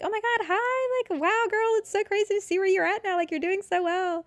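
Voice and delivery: very high, sugary fake-friendly voice; mock gushing. Former bullies messaging her now, high mock-gushing. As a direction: squeal it like a fake friend, stretched out.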